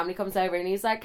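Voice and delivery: ordinary talk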